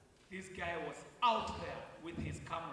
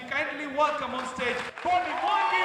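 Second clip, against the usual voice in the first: announcer shout; 9 semitones higher, 12 dB louder than usual. Real, not acted. MC introducing guest on stage.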